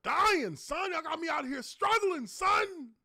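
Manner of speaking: high-pitched hammed-up struggling holler; exaggerated high voice of mock distress; mock complaint. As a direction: wail it comically high, with bursts of volume. Voices his younger self struggling.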